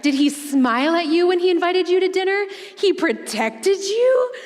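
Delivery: pry like a mother already planning a wedding; nosy mom. Retold or imitated. Mom grilling her daughter about a new guy, high and nosy. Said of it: "And, like".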